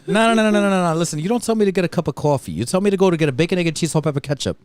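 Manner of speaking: fast, high New York rant; New York accent. Pretend. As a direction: rattle it off like a New Yorker.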